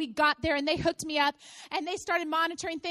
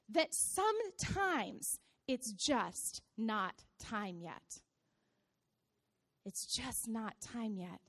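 slow, low and soft; hushed gentle point; 8 semitones lower, 10 dB quieter, much slower than usual. Real, not acted. Preacher consoling those waiting for something new, hushed. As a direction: let it sink in, almost murmured.

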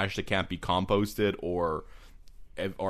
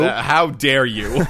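mock offended; 2 semitones higher, 9 dB louder, slower than usual.

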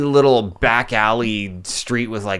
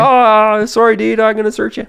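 high, loud mock cop; apologetic cop; 11 semitones higher, 5 dB louder than usual. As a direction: play the sorry policeman. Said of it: "He's like".

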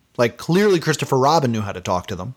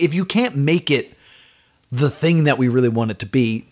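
ordinary delivery

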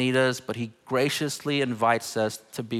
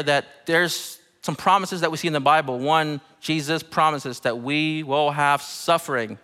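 ordinary delivery

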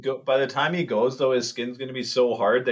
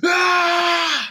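sudden loud high shout "Ah!"; retold yell; 6 dB louder than usual. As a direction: blast a loud high yell right in his face. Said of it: "He just yelled in my face".

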